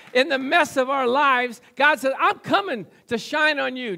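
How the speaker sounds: impassioned preacher building phrase on phrase; full-force preaching. Real, not acted. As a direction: proclaim it to the whole congregation.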